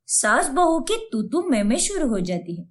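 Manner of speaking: ordinary delivery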